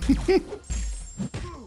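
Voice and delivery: airy laugh climbing high; high breathy laugh. Real, not acted. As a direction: laughs at his own joke name. Laughing at breezy butthole syndrome.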